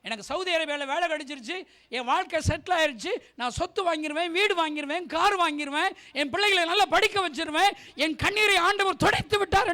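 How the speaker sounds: high, loud list climbing in force; mock boasting. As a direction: mimic a believer's boasts, building to a peak. Mimics self-satisfied believers' boasting. (பெருமை பேசுவதை உரக்க நடித்து)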